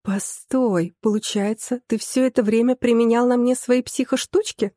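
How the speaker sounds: ordinary delivery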